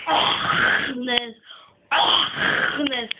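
Agnes slowed, with a long guttural rasp; exaggerated throaty pronunciation. Shown as a demonstration. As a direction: drag out the throat scrape in Agnes. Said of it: "You must say Agnes".

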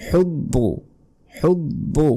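হুব্বু high, with a breathy throat ح and doubled ব; careful tajweed হুব্বু; strong ح. Shown as a demonstration. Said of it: "বলতে হবে ইয়াহুব্বু".

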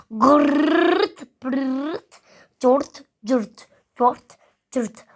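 punchy mouth-made rasps and pops; play-acted plop and trill noises; imitated toilet noises. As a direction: mimic rude bathroom sounds.

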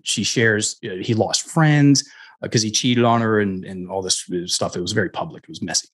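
ordinary talk, nothing marked

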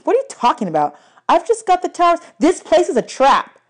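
high, irritated protest; annoyed girl voice. A narrator's reading. Annabeth hooked on the game.

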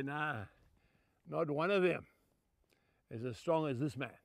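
put-on low, soft boyhood pride; quietly voiced awe of a father; hushed recollection. Recalls his boyhood awe of father.

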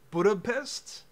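ordinary talk

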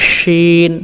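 শিন with a full sh and held ee; thick palatal sound. Shown as a demonstration. Teacher asking learners to copy, thick palatal শিন. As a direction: push air through a thick sh in শিন. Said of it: "বাংলায় যেমন উচ্চারণ হয় মোটা".

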